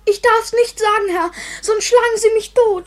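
high, loud panicky plea; frightened outburst. Acted. Boy to gentleman, terrified, high and loud. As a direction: blurt it out in fear of a beating.

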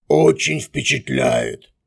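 slow, sneering "очень впечатляет"; mocking voice from the darkness. A narrator's reading. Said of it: "насмешливо произнёс голос из темноты".

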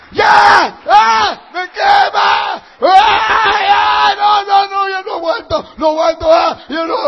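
high, loud agonized shrieks; drawn-out acted wails of torment; screaming in hell. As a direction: scream as if burning alive, unable to bear it. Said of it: "El que está en el infierno grita".

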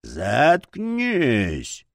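drawn-out monster cry, altered voice; monster's yell. A narrator's reading. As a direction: howl it like a tormented monster. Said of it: "заорало чудовище".

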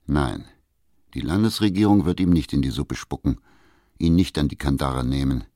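usual speaking voice

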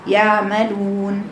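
slow يعملون with a weak, misplaced ع; wrong ayn. Shown as a demonstration. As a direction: imitate the floating ain in يعملون. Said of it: "بيقرأها كده". (عين عايمة)

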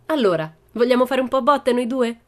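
put-on high, loud child voice; little girl's challenge. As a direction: challenge him brightly to a scrap. Pippi challenges the strongman.

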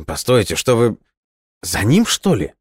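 startled question climbing high; taken aback. A narrator's reading. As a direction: stammer out disbelief, pitch jumping up. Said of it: "опешил Фостер".

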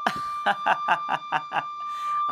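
storyteller's acted laughing fit; acted laughter. A narrator's reading. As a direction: play a character's drawn-out chuckle. Ant laughs at evening the score.

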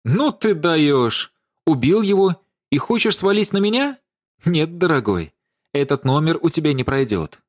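indignant. A narrator's reading. Даша refuses to take the blame for murder, indignant. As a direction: throw the blame back, incredulous. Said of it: "выкрикнула Даша возмущённо".

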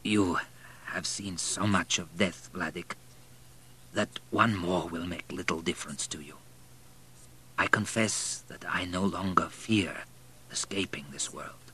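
halting, hushed deathbed speech; slow and laboured. A narrator's reading. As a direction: speak softly and slowly, each phrase an effort. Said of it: "spoke slowly and with considerable difficulty".

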